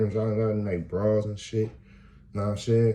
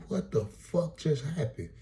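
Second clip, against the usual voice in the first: bewildered; 9 semitones higher, slower than usual. Retold or imitated. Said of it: "I'm like".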